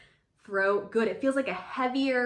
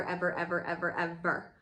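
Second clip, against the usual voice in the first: lower, trailing string of ever; repeated emphasis; 5 semitones lower, 4 dB quieter than usual. Shown as a demonstration. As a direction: lean on each ever in turn. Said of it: "Emphasis on the".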